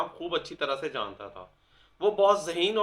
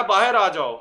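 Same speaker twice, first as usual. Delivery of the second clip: calling out; 3 semitones higher, 9 dB louder than usual. Retold or imitated. Calling someone out of house.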